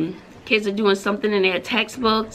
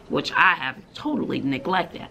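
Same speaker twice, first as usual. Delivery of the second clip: ordinary talk, nothing marked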